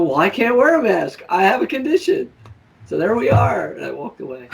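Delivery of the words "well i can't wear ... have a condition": higher mocking echo of her excuse; sarcastic higher parrot. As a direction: throw her words back, pitched up. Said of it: "I go".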